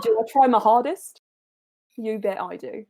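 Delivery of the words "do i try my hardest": louder, mock Australian turned cockney; cockney accent. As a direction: slip into a cockney twang. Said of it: "You went a bit cockney then".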